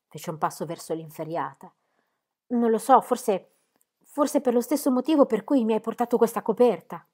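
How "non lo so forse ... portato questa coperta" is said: louder, higher, insistent pleading; urgent plea. Acted. Captive begging the archer to carry her message, urgent pleading. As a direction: push the words out, pleading.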